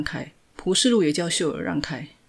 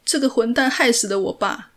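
higher, louder, voice close to tears; tearful accusation; 6 semitones higher, 6 dB louder than usual. A narrator's reading. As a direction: blurt it out through tears. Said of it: "蒲世禄哭着". (带着哭腔控诉)

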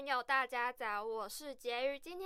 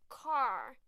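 American accent. Shown as a demonstration. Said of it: "美式英文的车子car".

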